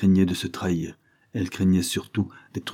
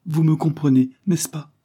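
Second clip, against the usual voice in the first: high, tearful pleading plea; a voice close to tears; choked, high, begging for understanding; sobbing; 8 semitones higher than usual. A narrator's reading. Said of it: "ajouta-t-elle en sanglotant".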